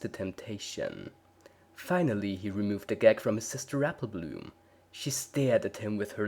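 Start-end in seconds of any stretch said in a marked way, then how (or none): none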